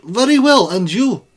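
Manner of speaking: put-on high, loud Russian accent. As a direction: ham up the Russian student. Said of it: "stupid Russian accent".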